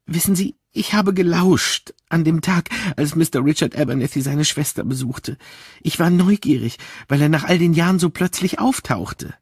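a worried woman reluctant to tell; upset, troubled maid. Acted. Miss Gilchrist voiced.

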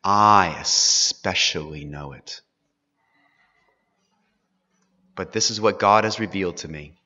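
calm surrender to God's will; quiet resolve. Acted. Jacob telling Joseph he obeys God, quiet and firm. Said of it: "which Jacob comes to say".

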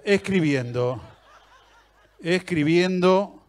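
escribiendo stretched out slowly, laughing; mock the endless typing wait; slow drawn-out. Shown as a demonstration.

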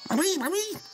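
put-on very high whiny child cry; squeaky child voice. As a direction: squeal it like a scared kid. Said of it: "Genau so".

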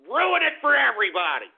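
angry shout, leaning hard on "ruin"; exasperated outburst. Real, not acted. Same complaint repeated in outburst.

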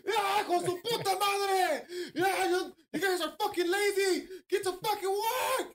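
imitated high-pitched furious rant; high, strained mock tirade; angry uncle yelling. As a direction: yell like a boss berating lazy workers. Impression of uncle raging at workers.

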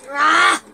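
sharp loud yelp; startled shout. Real, not acted. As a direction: yelp at a crash. Sudden crash in game.

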